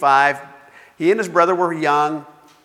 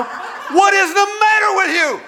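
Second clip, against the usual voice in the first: high, hammed-up shout at another driver; mock road rage; 4 dB louder than usual. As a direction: yell at the car ahead in exaggerated outrage. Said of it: "I started discussing it with him".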